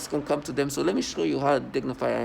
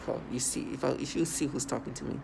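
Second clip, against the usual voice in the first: hushed aside; 2 semitones lower, 8 dB quieter than usual. Retold or imitated. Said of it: "I'm like".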